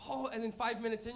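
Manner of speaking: ordinary talk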